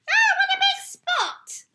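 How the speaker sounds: put-on high, loud cheeky children's stage whisper. Rude children stare at the shopkeeper's wart, loud whisper. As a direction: rude kids gawking out loud. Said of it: "in two loud whispers".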